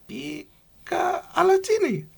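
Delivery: high, slow squeaky Pikachu call. Retold or imitated. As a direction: stretch it out like Pikachu. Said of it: "He was like".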